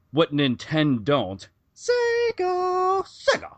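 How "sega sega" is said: epic shout. Acted. Triumphant shouted brand name.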